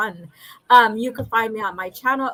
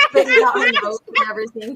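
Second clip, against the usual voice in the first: normal talking voice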